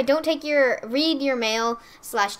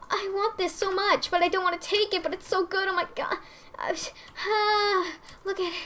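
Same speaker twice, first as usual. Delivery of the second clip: excited; 8 semitones higher than usual. Real, not acted. Torn over taking gifts.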